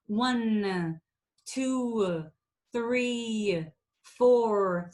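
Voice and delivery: "one", "two", "three", "four" with every consonant and vowel stretched and hit; exaggerated counting. Shown as a demonstration. Coach demonstrating the counting exercise, overdone. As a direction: count slowly, leaning hard on each sound. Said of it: "you exaggerate for the sake of the exercise".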